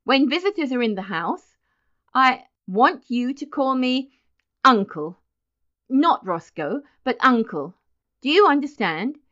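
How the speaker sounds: loud, emphatic, broken into firm pieces; stern ordering. A narrator's reading. Roscoe telling Benjamin to call him Uncle, sternly. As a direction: lay down a rule, punching each phrase. Roscoe lays down rule, scolding.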